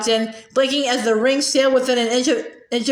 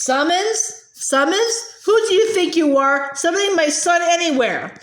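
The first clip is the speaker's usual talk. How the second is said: higher, incredulous rage; outraged; 4 semitones higher than usual. A narrator's reading. Father outraged at his son's summons, incredulous. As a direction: spit the word back at him.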